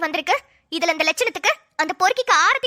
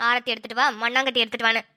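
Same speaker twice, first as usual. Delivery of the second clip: low, softer sarcastic mimicry; mocking grumble; 7 semitones lower, 4 dB quieter than usual. Wife mimics husband's order to herself, sour mocking grumble. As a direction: grumble his order back under your breath.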